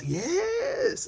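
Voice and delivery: a high scoffing yes; sergeant scoffing. Acted. Wounded sergeant mocking the idea of fear, high scoffing yes. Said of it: "the sergeant replies".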